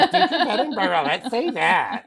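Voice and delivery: exaggerated posh British accent, laughing; hammy upper-class British, drawn out. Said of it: "let me talk like this".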